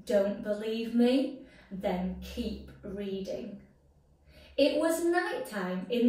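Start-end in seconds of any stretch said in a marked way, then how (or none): none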